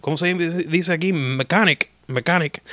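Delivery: lightly voiced old doctor admitting he fixes cars; a hint of the old Cuban doctor. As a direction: shrug it off casually, then reach for the English word. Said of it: "So that was Doctor Hill".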